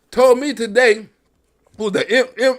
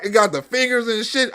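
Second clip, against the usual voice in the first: usual speaking voice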